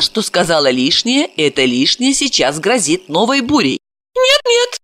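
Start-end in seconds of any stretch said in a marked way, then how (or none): 4.1-4.8 hasty denial. Acted. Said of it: "поспешно возразила она".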